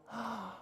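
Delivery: put-on sharp inhale, demonstrating for kids; deep breath in. Shown as a demonstration. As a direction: breathe in big, like catching a bubble. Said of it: "Can you catch a bubble?".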